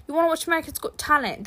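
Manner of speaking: quick, high attempt at Scottish that lands British; little girl's voice in a badly faked Scottish accent; botched Scottish accent. Little girl asking to watch TV, botched Scottish accent. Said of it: "that's a British accent. It's not Scottish".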